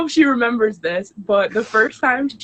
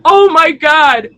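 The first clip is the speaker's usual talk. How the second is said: much higher, loud, slow screams of disbelief; ecstatic screaming; 7 dB louder, slower than usual. Real, not acted. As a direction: shriek with pure joy and shock. Host reacts to a huge gift.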